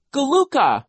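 high, loud little shriek; Sonny shrieking. A narrator's reading. Little sister backs up her siblings, shrieking. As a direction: shriek the baby word. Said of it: "Sonny shrieked".

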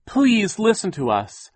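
high, loud, drawn-out plea; begging. A narrator's reading. Violet appeals to Mister Poe, begging. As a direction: implore him to listen. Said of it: "Violet begged Mister Poe".

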